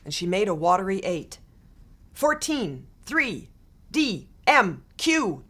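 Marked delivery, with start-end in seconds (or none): 2.1-5.4 shouted out. A narrator's reading. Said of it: "he shouted out".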